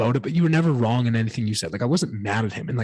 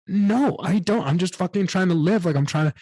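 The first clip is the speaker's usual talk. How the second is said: raised, higher pleading protest; protesting to dad, voice pitched up; defensive protest; 7 semitones higher than usual. Retold or imitated. To her dad in the truck, a higher defensive protest.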